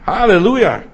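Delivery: loud, high Halleluja called out; proclaimed Halleluja. Acted. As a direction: shout praise over the trouble. Proclaiming Halleluja over problems. (laut und hoch ausgerufenes Halleluja)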